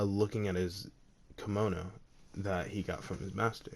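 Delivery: ordinary delivery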